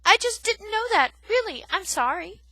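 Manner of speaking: high, loud pleading; frightened cry. Acted. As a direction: cry out in panic. Poppy apologizing in fear.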